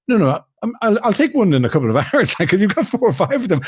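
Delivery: high, hammed-up dithering protest; mock stalling to stay for duty free; played-up reluctant passenger. To the airline clerk, a hammed-up high protest. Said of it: "I know I played up that".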